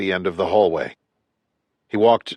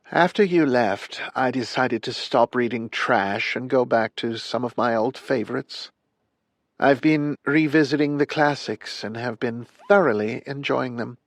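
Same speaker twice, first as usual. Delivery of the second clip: higher, thin-voiced elderly lady; frail old woman; 5 semitones higher than usual. Frail dying old woman speaks.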